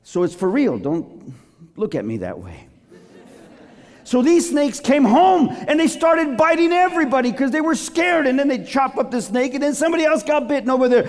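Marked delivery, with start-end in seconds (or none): 4.0-11.1 high, loud fiery preaching; preaching at full force. Acted. Preacher booming the Numbers story to his flock, at full force. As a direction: hammer the disaster home to the congregation.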